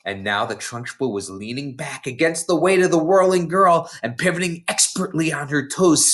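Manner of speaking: ordinary delivery, nothing marked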